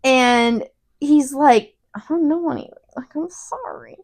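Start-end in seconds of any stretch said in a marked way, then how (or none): 1.9-4.0 sheepish, fading into a whispered sorry; retold timid mumble of apology; meek apology. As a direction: voice drops away to a whisper. Retells Harry answering Snape meekly.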